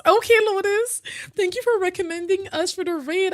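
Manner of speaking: high, loud, excited call to Lotus. Real, not acted. Streamer thanking Lotus for the raid, excited and high.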